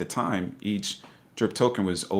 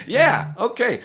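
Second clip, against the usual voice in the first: high, louder, doubtful okay; humouring mock agreement; 13 semitones higher, 5 dB louder, slower than usual. Uncle brushing off his nephew's tip, humouring him. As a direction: play along in a high voice. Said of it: "I was like".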